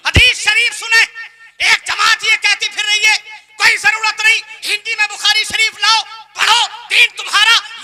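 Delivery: loud, high fiery sermon delivery; full-force preaching. Acted. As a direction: preach it out at the top of your voice. (पूरे ज़ोर से ऊँची आवाज़ में तक़रीर)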